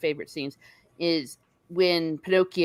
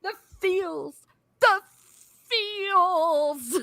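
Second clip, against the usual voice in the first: very high, drawn-out emotional squeal; overcome with feels; much slower than usual. Real, not acted. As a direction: wail it in tiny high voice, gushing. Overcome by the touching scene.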